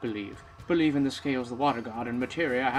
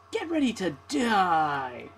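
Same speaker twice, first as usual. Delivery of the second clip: villain threat; 5 semitones higher, 4 dB louder, much slower than usual. Acted.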